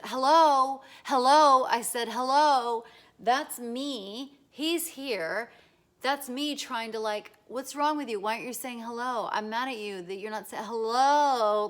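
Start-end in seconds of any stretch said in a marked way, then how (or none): 0.0-5.5 loud insistent hellos; demanding hello. Acted. Neighbour demanding a hello, shouting. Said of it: "Can you imagine if I said". 7.5-11.7 loud sarcastic reproach; sarcastic scolding. Acted. Neighbour mocking the man for not saying hello, sarcastic. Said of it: "like, sarcastic or something".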